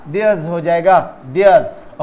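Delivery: Theirs with a long vowel, no r, diəz; there's as theirs. Shown as a demonstration. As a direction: say Theirs, r silent. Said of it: "तो their नहीं होगा". (Theirs, आर silent)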